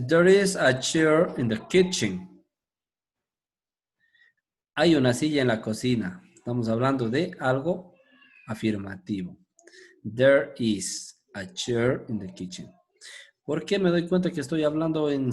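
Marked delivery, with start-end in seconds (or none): 0.1-2.4 slow, careful English model reading; slow teacher demo; slow model sentence. Shown as a demonstration. 10.0-12.7 slow, even English model reading; slow classroom demo; slow model sentence. Shown as a demonstration.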